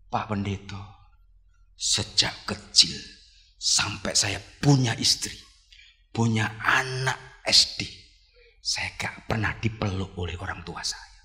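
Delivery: low, slow retold confession; halting and subdued, phrase by phrase; patient confiding. Patient telling the pastor of a hugless childhood, subdued and slow. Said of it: "orang edan tuh ngomong gini".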